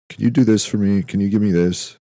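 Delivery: high, loud mock begging to God; mock pleading. Asking God for favors in prayer, mock pleading.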